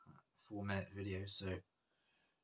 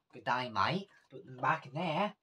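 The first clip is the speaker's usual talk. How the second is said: louder, higher mock Australian accent; really bad Australian accent; 4 semitones higher, 8 dB louder than usual. Vlogger putting earrings back, in a bad Australian accent. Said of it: "That was a really bad Australian accent".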